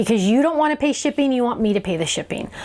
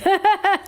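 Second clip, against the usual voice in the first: high-pitched real laugh; 9 semitones higher than usual. Real, not acted. Host pictures viewer's cat beating her dog, high laugh. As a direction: burst out laughing at the idea.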